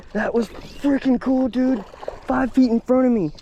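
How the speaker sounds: high, loud, slow amazement; thrilled. Real, not acted. Angler just hooked a red five feet away, high thrilled exclaiming. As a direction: stretch out the amazement, full of adrenaline.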